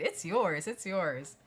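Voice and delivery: high, loud and beaming, pushing the key; eager attendant. Retold or imitated. Parking attendant insisting to the owner, high and eager. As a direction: play the delighted attendant handing over keys. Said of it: "trying to shove it into my hand".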